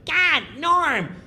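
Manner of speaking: high, whiny heckle; mock Kattan jabbing at Norm; Kattan needling. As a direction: nag Norm in a high put-on whine. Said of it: "Kattan's going".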